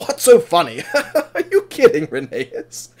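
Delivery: acted helpless laughter through the words; high, incredulous, laughing; chuckling. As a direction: laugh in disbelief at a friend. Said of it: "starts chuckling".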